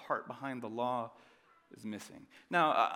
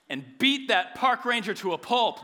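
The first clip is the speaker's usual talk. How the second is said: high, loud mock fury; hammed-up fierce outburst; mock fierce exclamation; 11 semitones higher, 12 dB louder than usual. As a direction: belt it out like an angry mama bear. Mock Mama Bear exaggeration.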